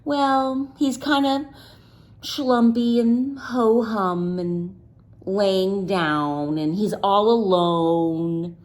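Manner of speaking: ordinary delivery, nothing marked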